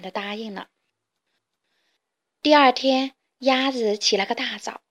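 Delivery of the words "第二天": usual speaking voice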